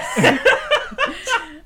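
loud laughing burst; burst of laughter. Real, not acted. Kids' joke cracks her up.

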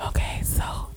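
breathy ASMR whisper, unvoiced. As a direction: whisper close to the mic like an ASMR video. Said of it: "A S M R review".

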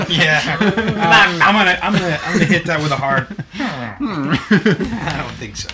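laughing hard through the words; cracking up, can barely talk. Real, not acted. Laughing fit over joke.

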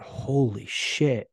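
drawn-out, slow retold reaction; stunned realization. Man realizing he has been lying, slow and stunned. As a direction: stretch both words out as it sinks in.

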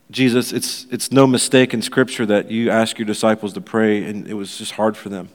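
earnest pastor's prayer to the congregation; public prayer. Acted. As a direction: lead the church in heartfelt prayer.

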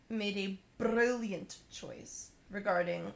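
slow, slightly Scottish-sounding read; hint of a put-on Scottish accent. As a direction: slip into a light Scottish-Russian accent. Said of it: "Got a little Scottish there".

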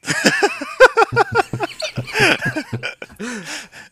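loud, high hag-like laugh; long, loud high-pitched laughing; high cackle. Acted. Hag reacts to haggling.

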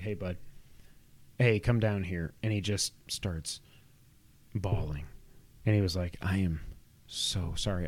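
put-on soft, tender reassurance to a crying boy; gentle fatherly comfort.

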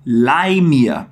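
"Leih mir" said slow and clear for repeating; model phrase. Shown as a demonstration. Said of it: "Repete aí comigo".

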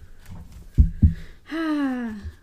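long, audible sigh of defeat; exasperated sigh. Real, not acted. Co-host gives up on the unadventurous partner, sighing heavily.

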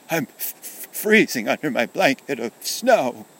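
high, shivering stammer; far away and sad. Acted. Soldier buried in snow answering Kenny, shivering and sad. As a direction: stutter it out as if freezing. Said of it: "seemed to come from far away, and it was sad".

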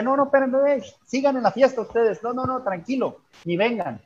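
high-pitched, mild mock yell; retold shout. Priest urging partygoers to stay at the party, mock shout. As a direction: imitate calling out to hold people back. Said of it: "les grité".

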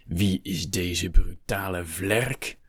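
slow, drawn-out disdain; haughty contempt. Acted. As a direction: stretch every word with scorn. Haughty Hector demands who interrupted.

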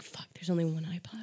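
hushed panicked whisper. Retold or imitated. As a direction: breathe it out in shock. Panicked discovery at home.

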